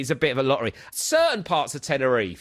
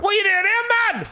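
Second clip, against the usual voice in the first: high, very loud Geordie bellow; shouting Geordie bloke; 4 dB louder than usual. Retold or imitated. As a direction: imitate a tipsy Geordie shouting across a queue. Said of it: "there was a Geordie bloke in the queue".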